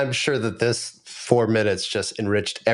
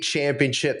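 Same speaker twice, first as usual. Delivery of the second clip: ordinary talk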